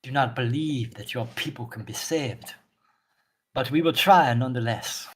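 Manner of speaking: lightly voiced solemn zealot; stern zealot priestess, measured. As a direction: speak as a stern bringer of order, measured. Plays NPC Orthelia.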